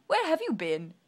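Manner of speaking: loud chirpy question; Mister Happy chirps his question; cheery question. Acted. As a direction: ask it brightly.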